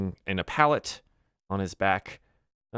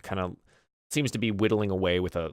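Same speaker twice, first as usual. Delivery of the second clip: usual speaking voice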